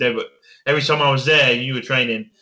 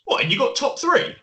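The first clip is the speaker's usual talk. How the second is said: high, astonished follow-up question; can't believe what you just heard; incredulous; 11 semitones higher than usual. Real, not acted. Disbelief despite top three placing.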